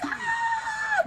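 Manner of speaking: high-pitched imitated scream; long squealed "ah" of joy; mock excited scream. Imagining son alone with the news, mock screaming.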